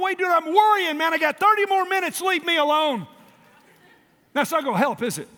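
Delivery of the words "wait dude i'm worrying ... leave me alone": higher, louder mock protest; mock annoyed worrier. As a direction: shoo callers away, busy worrying. Mock busy worrier on phone.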